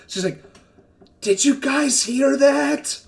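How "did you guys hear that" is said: oversized stage whisper, drawn out; giant's failed whisper. Acted. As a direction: try to whisper but boom it. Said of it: "he thinks he's whispering, but it's a giant".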